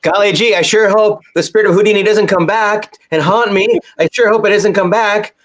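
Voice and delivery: high, gee-whiz mock innocence; wholesome 1950s kid. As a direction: play an earnest 1950s kid. Mock wholesome kid fearing ghost.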